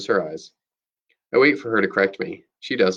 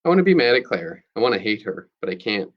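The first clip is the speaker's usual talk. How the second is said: usual speaking voice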